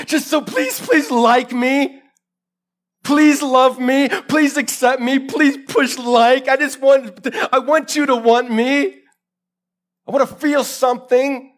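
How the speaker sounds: high, drawn-out begging; desperate pleading. Acted. Preacher voices broken girls begging for likes, desperate pleading. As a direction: plead slowly and loudly, stretching each plea.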